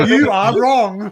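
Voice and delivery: slow, loud pronouncement; mock BBC teacher. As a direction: declare it slowly and loudly, mock-instructional.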